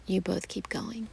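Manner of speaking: ordinary delivery, nothing marked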